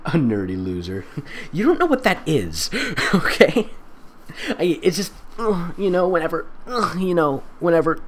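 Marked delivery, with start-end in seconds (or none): none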